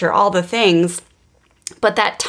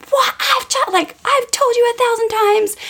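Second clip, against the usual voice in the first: mock yell, pitched much higher; retold scream. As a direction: replay the shriek at the kid over the spilled bubbles. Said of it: "And I just screamed".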